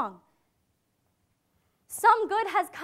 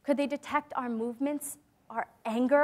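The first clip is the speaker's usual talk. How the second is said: ordinary delivery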